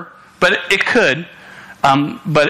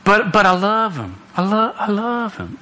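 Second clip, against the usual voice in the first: higher-pitched imitation of a pleading girl; mock lovesick protest; 6 semitones higher than usual. Preacher imitating a girl defending her boyfriend, mock pleading.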